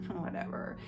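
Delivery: whispered whatever. Retold or imitated. Wife whispering her dismissal of husband's flattery. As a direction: breathe it out, not caring. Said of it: "And I'm just like".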